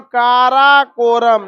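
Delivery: Karakoram drawn out slowly, part by part; spaced-out syllables. Shown as a demonstration. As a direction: stretch each long vowel in Karakoram. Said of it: "अलग अलग अक्षर में लिखा". (काराकोरम को धीरे, खींच-खींचकर)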